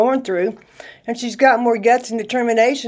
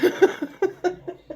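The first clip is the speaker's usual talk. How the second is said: real laugh; 7 semitones higher, 4 dB quieter than usual. Real, not acted. Laughs at her own joke.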